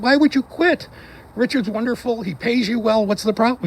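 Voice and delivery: high-pitched, incredulous questioning; retold parental disbelief, pitched high; baffled parent. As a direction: press your kid for an explanation, voice way up. Parent questioning son who quit job.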